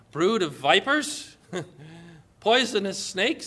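laughing, slow rising question; amused disbelief. Real, not acted. Preacher marvelling at Jesus' bluntness, laughing in disbelief. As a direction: savour the phrase with a chuckle.

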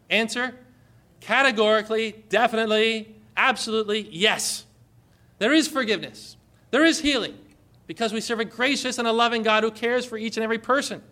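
fervent sermon climax; preaching at full force. Acted. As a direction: proclaim forgiveness with fervor.

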